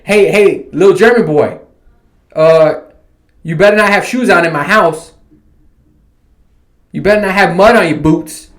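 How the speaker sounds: loud, high telling-off; mock scolding. Host imagines ordering shoes off in the house, loud mock scolding. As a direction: scold the kid like you own the house.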